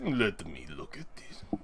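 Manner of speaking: low, slow, very soft; quiet, deliberate and deep; hushed. Acted. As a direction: murmur it slowly in a low voice. Smith takes the writ.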